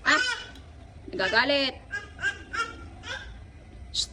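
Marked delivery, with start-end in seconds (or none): none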